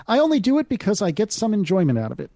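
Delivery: ordinary talk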